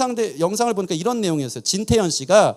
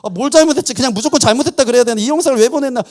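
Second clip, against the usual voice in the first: loud, high, rushed fretting; a husband scrambling in a panic; frantic self-questioning, much louder and higher; panicked; 5 semitones higher, 8 dB louder, faster than usual. Retold or imitated. Panicked husband's inner thoughts.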